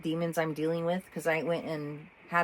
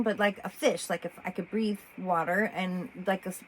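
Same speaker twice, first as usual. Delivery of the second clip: ordinary delivery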